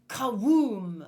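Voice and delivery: loud booming sound effect; giant step sound. Shown as a demonstration. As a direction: boom it like a giant footstep. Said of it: "That is the sound of a giant step".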